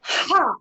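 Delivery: loud high Ha blasted on the breath out; shouted Ha. Shown as a demonstration. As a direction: shout Ha from the belly. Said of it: "Breathe in".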